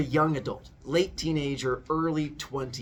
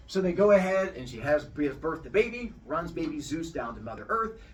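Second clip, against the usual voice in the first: ordinary talk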